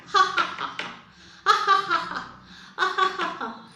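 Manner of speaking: high, loud staccato ha-ha-ha; hammed-up storybook laughter in bursts; mocking rabbit laugh. Rabbit laughs at the tortoise, high acted cackle. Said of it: "this rabbit started laughing".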